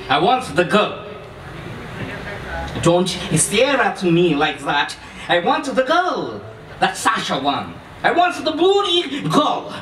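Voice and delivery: insistent, swelling demands in a put-on voice; growing exasperation, pitch leaping on the demands; angry stranger.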